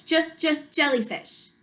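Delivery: "j, j" as short separate sounds before "jellyfish"; phonics-style "j, j" leading into "jellyfish"; sounding out. Shown as a demonstration. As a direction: isolate the first sound, "j, j", then say "jellyfish". Sounding out first letter.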